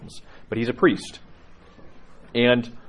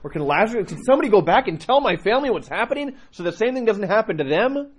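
high, loud desperate appeal; urgent pleading; 10 semitones higher, 5 dB louder than usual. Acted. Rich man begging to warn his family, urgent pleading. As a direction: plead higher, voice lifting at the ends.

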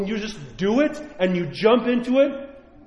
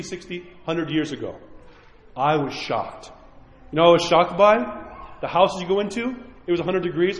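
ordinary delivery

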